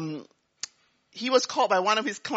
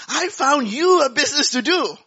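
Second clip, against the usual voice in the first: louder, higher and laughing; excited; 7 semitones higher, 7 dB louder than usual. Retold or imitated. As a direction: burst in full of news. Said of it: "He was very excited".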